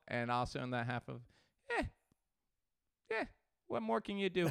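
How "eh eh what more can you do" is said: helpless shrug. Retold or imitated.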